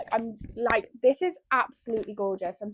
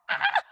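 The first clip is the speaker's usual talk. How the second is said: very high squeal of delight; excited squeal. Real, not acted. Reader squealing at the book picked for her, excited squeal. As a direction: let out a short, high-pitched squeal.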